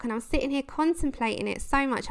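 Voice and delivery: ordinary talk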